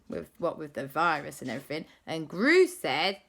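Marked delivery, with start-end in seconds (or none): none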